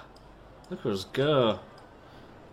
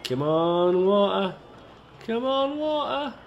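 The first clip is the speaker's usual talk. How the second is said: pleading for water; 7 semitones higher than usual. Real, not acted. Urging the game to show water.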